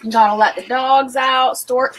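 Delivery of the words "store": normal talking voice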